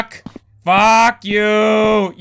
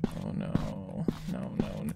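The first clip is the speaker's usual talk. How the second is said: whispered dread; 16 dB quieter than usual. Real, not acted. Dreading the burning story.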